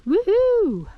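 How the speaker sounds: high, loud woohoo; excited cheer. Real, not acted. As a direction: cheer the border crossing. Crossing first state border.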